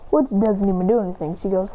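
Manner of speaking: usual speaking voice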